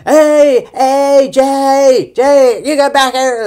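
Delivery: high, loud yelling in a thick Texas twang; shouting in a Texas accent. Retold or imitated. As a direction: shout it like the bickering leads. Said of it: "With these two voices".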